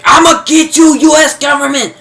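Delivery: high, loud vow of revenge; hammed-up vengeful yell at the government; vengeful mock shout. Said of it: "um, vengeful. She's like".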